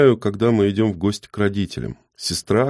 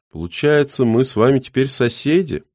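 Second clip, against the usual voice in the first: put-on a touch higher old man; hint of an old neighbour; lightly voiced old man; 7 semitones higher than usual.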